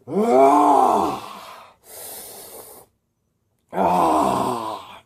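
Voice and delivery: villain overloads with power; power scream. A narrator's reading. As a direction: scream as energy surges. Electro absorbing power, screaming.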